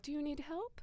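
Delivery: high, soft, questioning stranger's voice; helpful stranger. Retold or imitated. Stranger offering help to a lost traveler, high and soft. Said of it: "Was that your Japanese accent?".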